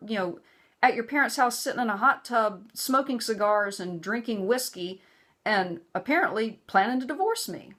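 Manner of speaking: high, fast heated protest; retold angry phone outburst; angry retort. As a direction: fire back at him, indignant. Her angry reply on phone.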